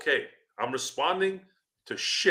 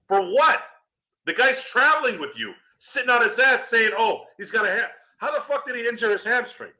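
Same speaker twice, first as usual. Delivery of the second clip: high-pitched, exasperated ranting; incredulous rant; 8 semitones higher than usual. Real, not acted. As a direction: demand an explanation in heated disbelief. Angry rant about a faked injury.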